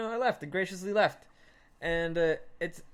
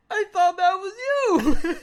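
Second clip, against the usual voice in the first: high, loud, slow wail; imitated desperate yell; 12 semitones higher, 9 dB louder, slower than usual. Storyteller acts lost friend answering him, high drawn-out yell. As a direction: cry it out like the rescued friend.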